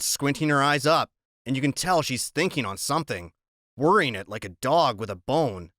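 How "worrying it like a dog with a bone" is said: plain reading voice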